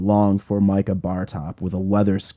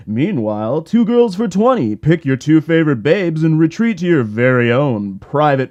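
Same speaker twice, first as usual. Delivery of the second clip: higher, louder hyped announcer voice; strip club DJ; 8 semitones higher, 6 dB louder than usual. Acted. DJ announcing the next dancer to the club, hyped announcer voice. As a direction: sell it like a cheesy club DJ. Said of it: "familiarly irritating voice of a strip club D J".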